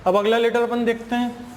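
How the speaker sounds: normal talking voice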